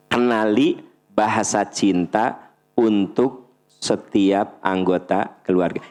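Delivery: slow, deliberate "Kenali" stressed; slow dictation. Shown as a demonstration. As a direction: dictate slowly for notes. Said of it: "slow dictation". (didiktekan pelan-pelan)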